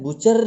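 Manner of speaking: बुचर with the final र clearly pronounced; sounded final r. Shown as a demonstration. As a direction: say बुचर ending in a hard "r". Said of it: "बुचर नहीं बोलते हैं इसको". (बुचर के अंत में र साफ़ बोलकर)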